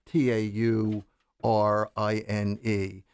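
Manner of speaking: slow, letter by letter; spelling out letters. Shown as a demonstration. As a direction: spell taurine out slowly, letter by letter. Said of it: "another amino acid is called taurine".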